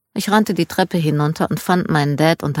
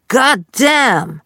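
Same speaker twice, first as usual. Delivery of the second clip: Southern drawl, "God damn!" shouted higher and louder with a falling end; 7 semitones higher, 4 dB louder than usual. Retold or imitated. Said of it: "wie ein Südstaatler".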